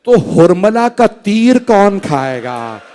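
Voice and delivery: hushed loving father's voice; tender father. Acted. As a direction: whisper it gently like a father to his infant. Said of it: "मौला हुसैन ने फरमाया होगा".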